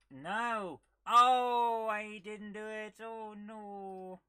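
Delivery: sudden loud, high cry of dismay, then sinking; real shock as the jump fails; dismayed outcry. Real, not acted. Character falls in game.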